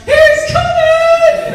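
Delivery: put-on very high, loud, drawn-out child's scream, laughing; stretched, high playground squeal; kids' panicked shriek. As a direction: shriek it like kids fleeing a naked toddler. Neighborhood kids fleeing naked son.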